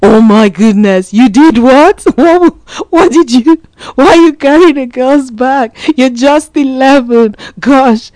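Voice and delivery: high, loud, incredulous ranting; inner shock. Retold or imitated. Mom voicing her hidden panic over her eleven-year-old son, high incredulous rant. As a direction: replay the panic you kept inside. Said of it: "But inside me, I was like".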